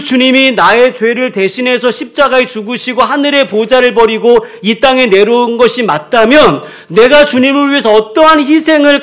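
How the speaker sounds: loud, high, driving sermon voice; fervent preaching. Acted. Pastor urging the congregation about sacrifice, loud fervent preaching. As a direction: build the conviction to a peak.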